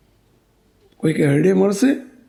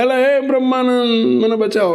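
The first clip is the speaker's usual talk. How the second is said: storyteller's pleading cry, pitched up; acted plea for rescue; 5 semitones higher than usual. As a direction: stretch the name out as if begging to be saved. Dying man calls on swami.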